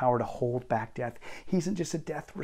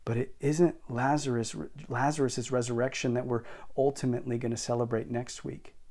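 ordinary talk, nothing marked

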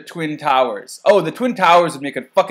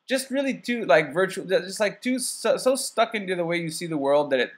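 normal talking voice

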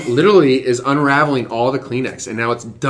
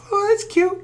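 cooing high. Retold or imitated. Watching baby monkeys bounce on vines, high cooing. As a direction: squeak of delight.

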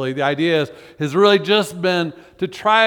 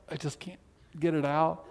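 soft, strained under the breath; as if the words stick in the throat; choked; 11 dB quieter than usual. Acted. Pastor acts out a prayer stuck in the throat, hushed.

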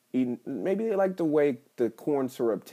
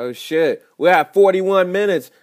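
startled realization; 8 semitones higher, 11 dB louder than usual. Real, not acted. Suddenly notices the time.

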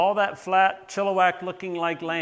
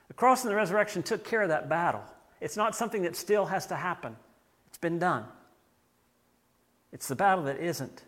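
ordinary delivery

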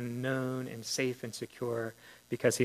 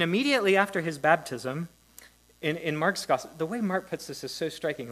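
ordinary talk

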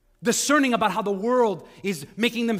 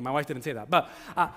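ordinary delivery

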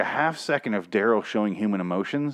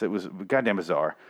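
normal talking voice

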